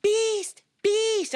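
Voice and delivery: calling out. Acted. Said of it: "I hear her voice in the garden".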